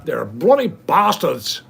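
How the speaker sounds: slow, loud, hammed-up Australian drawl on bloody bastards; mock Aussie bloke, played for a laugh; imitating an Australian, slow and loud; Australian accent. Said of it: "They sound cool".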